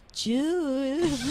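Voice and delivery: mock seductive name call; sexy voice. Shown as a demonstration. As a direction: purr the name teasingly. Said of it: "this is my sexy voice".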